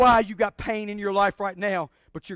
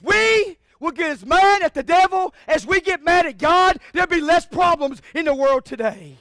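loud, raised preacher voice; preaching shout; 6 semitones higher, 7 dB louder than usual. Real, not acted. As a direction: stir up anger at the devil. Preaching peak.

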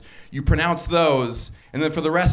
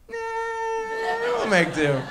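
squeaky high, dismissive nah; much slower than usual. Retold or imitated. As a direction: toss off the offer in a high voice.

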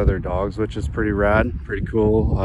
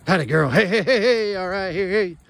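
excited dog praise; 10 semitones higher than usual. Real, not acted. Owner cheering his dog on, excited and high. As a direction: hype the dog up after she grabs it.

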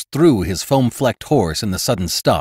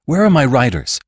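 higher-pitched, pressing question; urgent demand; 6 semitones higher, 3 dB louder than usual. Acted. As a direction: demand an answer, pitch raised. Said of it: "demanded Jane".

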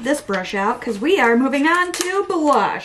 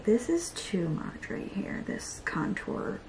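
voiceless aside about the contour; whisper; 10 dB quieter than usual. Real, not acted. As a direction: whisper it to yourself. Muttering about too much contour.

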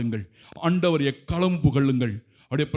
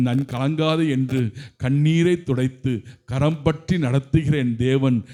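ordinary talk, nothing marked

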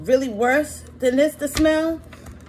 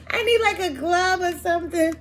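exasperated; 7 semitones higher, 3 dB louder than usual. Real, not acted. Struggling with spiky fruit.

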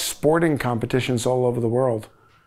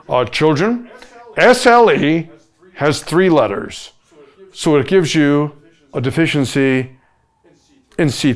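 professorial voice; 3 dB louder than usual. Pretend. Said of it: "I'm gonna do it just for you".

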